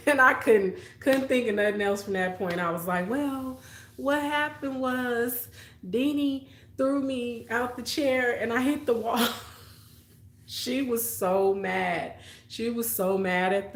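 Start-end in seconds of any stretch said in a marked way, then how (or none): 3.1-9.3 put-on a child finally spilling it; kid confessing.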